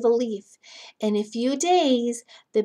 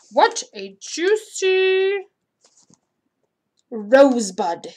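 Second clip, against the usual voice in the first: aphid voice; 3 semitones higher, 6 dB louder, slower than usual. Acted. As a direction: play a greedy little bug. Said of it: "I think those are the aphids talking".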